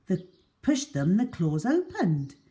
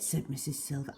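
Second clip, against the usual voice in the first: ordinary delivery, nothing marked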